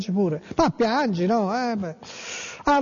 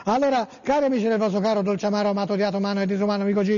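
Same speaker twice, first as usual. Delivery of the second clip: rapid host patter; much faster than usual. Acted. Fast show-host greeting.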